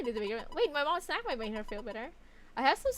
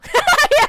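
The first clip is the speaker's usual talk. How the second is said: loud burst of laughter; 16 dB louder than usual. Real, not acted.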